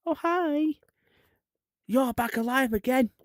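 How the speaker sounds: cheery Jesus voice. Retold or imitated. Jesus greeting Mary at tomb.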